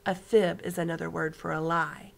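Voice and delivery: ordinary delivery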